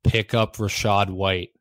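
leaning into the mic. Real, not acted. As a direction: close to the mic, slow and loud. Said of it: "I'm just gonna lean into the microphone".

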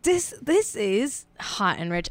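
put-on light Cockney accent on the intro; a hint of Cockney greeting. Host greets viewers in a touch of Cockney. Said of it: "I did a Cockney accent".

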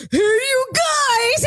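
high, slow, drawn-out call to the room; mock doctor hollering for everyone.

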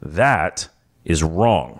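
low, very slow, firm; slow emphatic. Real, not acted. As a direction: stretch each word for weight. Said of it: "emphatic correction".

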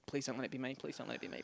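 mock prayer murmured softly; pleading murmur. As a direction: pray under your breath. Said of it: "I go".

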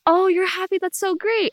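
high-pitched imitation of a delighted dad; acted fatherly gladness; pleased dad.

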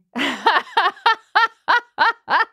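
high, gleeful witch cackle. Real, not acted. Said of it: "That might be my favorite witch cackle ever".

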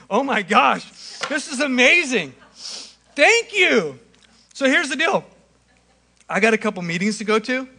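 hammed-up excitement at a free spread. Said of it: "it would go something like this".

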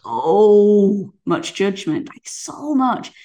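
slow stress, fading to a whisper; emphatic then whispered. Real, not acted. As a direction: drag it, then whisper so much.

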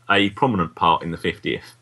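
ordinary delivery

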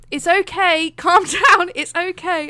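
hammed-up hushing of a shaking patient; soothing staff. Dental staff hush the trembling patient, mock soothing.

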